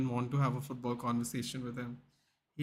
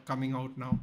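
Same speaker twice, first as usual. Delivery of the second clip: ordinary talk, nothing marked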